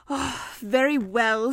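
slow, drawn-out giving in; grudging consent. A narrator's reading. Pooh sent off to fetch honey, slow grudging consent. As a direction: concede reluctantly, stretching the words.

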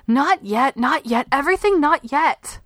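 put-on high, bitter girl's repeat of the refusal; a child throwing her mother's words back; frustrated child. A narrator's reading.

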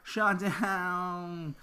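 high, theatrical "Shot down."; dramatic high repeat. Acted. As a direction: sing out "Shot down.".

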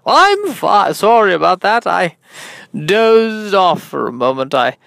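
hammed-up interviewer, drawing out words; [name]. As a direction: recover grandly and recount it with relish. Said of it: "I'm your host, [name]".

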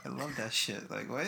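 ordinary talk, nothing marked

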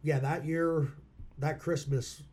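ordinary delivery

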